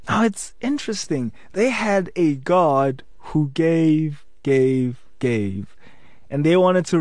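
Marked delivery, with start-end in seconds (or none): 1.5-5.7 each gave slower and lower, fading away; dramatic fade. Shown as a demonstration. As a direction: let the gave sink lower each time. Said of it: "repeated gave, fading".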